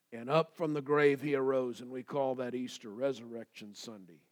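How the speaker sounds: ordinary talk, nothing marked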